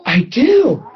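high, tearful and eager, voice catching; excited tearful reply. Retold or imitated. Woman in the front row answering the preacher, high and tearful. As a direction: retell her near-tearful eagerness to be healed. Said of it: "She said".

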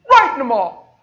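loud, high, tearful exclamation; loud crying exclamation. Retold or imitated. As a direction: cry it out in amazement. Retelling emotional healing moment.

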